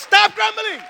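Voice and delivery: high, loud drawn-out shout; shouted command. Acted. Preacher relaying the command to grumblers, high shout. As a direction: cry it out as a rebuke.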